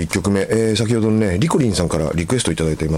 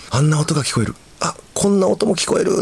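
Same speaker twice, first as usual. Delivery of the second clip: higher-pitched, lightly thrilled reading; a touch of wonder, pitched up; mild excitement; 8 semitones higher than usual. Retold or imitated. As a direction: read it like a listener discovering new sounds. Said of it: "とてもワクワクしました".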